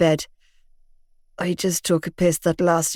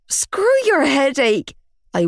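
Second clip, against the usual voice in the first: wailing; 8 semitones higher than usual. A narrator's reading. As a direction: wail at him in despair. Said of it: "I wailed".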